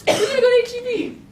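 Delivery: high, loud burst of excitement; mock hype. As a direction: announce it like a big teenage adventure. Hyping up teenage night out.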